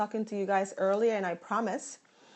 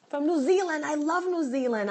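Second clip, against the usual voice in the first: high, thrilled exclamation; real, higher-pitched enthusiasm; delighted excitement; 6 semitones higher than usual. Real, not acted. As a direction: burst out with delight at the viewer's country. Delighted by New Zealand viewer.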